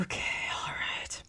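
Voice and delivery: soft, breathy whisper to himself. Acted. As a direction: whisper it under the breath, almost to yourself. Quiet aside after a sigh.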